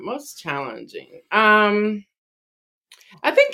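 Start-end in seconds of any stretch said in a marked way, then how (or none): 0.0-1.2 much softer, falling, said to herself; quiet musing aside; murmured to self. Real, not acted. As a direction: mull it over under your breath. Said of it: "Let me think for a moment".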